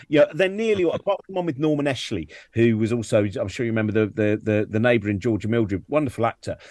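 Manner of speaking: normal talking voice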